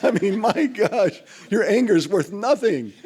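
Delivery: loud, high teasing through laughter; laughing at a friend's feeble chair throw. Real, not acted. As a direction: crack up while mocking the throw. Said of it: "I remember laughing".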